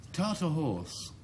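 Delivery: normal talking voice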